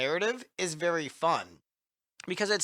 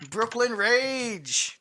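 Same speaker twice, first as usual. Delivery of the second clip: put-on Brooklyn accent; 7 semitones higher, 3 dB louder, much slower than usual. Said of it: "really thick Brooklyn accent".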